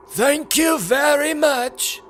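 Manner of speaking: high, slow character voice; Death Eater's reply. A narrator's reading. One Death Eater thanks another for the compliment, high and drawn-out. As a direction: draw it out high in a put-on voice.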